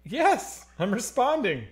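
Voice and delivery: high, loud answer down the line; exasperated reply. Real, not acted. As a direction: insist loudly to a caller who can't hear. Caller cannot hear him.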